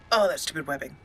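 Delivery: normal talking voice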